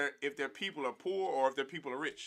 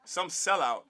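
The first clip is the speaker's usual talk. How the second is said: normal talking voice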